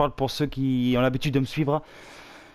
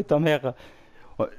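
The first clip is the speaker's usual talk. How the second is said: ordinary talk, nothing marked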